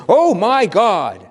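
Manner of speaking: loud, high, drawn-out gushing; exclaiming to spouse. Acted. Telling your spouse how special they are, loud exclamation. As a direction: exclaim it big, stretching each word. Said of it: "turning to your spouse and saying".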